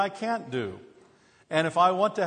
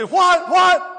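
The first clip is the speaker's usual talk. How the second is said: high, loud, startled double what; alarmed what what; 12 dB louder than usual. Retold or imitated. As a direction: snap back in alarm at the sudden scream. Startled reply to wife's shriek.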